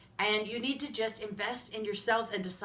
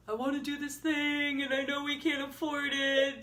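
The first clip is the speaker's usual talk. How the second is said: higher, strained pleading; retold tearful plea; 6 semitones higher than usual. Wife retelling her plea to her husband, tearful. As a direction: plead as if through tears, held high. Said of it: "I cried to him and I said".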